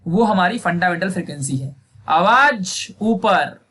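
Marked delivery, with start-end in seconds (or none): 2.1-3.7 very slow, drawn-out filmi line, voice pushed up; Akshay Kumar style, raised. Retold or imitated. Teacher mimics the Akshay Kumar line, rising version. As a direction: stretch it like a movie hero going up. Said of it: "Akshay Kumar का dialogue याद आएगा".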